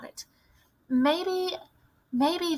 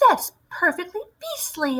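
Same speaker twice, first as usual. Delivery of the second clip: put-on high, young outcry of disgust; a boy horrified by the story; boy crying out; 9 semitones higher than usual. A narrator's reading. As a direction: grandson recoiling in horror at the witches. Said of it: "I cried".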